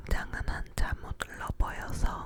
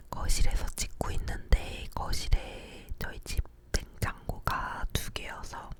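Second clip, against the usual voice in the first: normal talking voice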